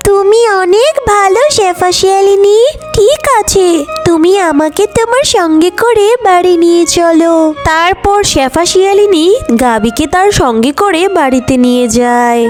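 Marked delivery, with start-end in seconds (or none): 0.1-7.3 high-pitched, thankful cow character; grateful cow. Acted. Cow agreeing to go home with fox, grateful. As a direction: accept the kindness in a raised voice. Cow accepts fox's offer.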